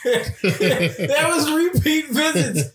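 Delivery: high, slow, drawn-out words through laughter; real laughter breaking into a high-pitched exclamation; laughing exclamation. Real, not acted. Cracking up over fake doctor story.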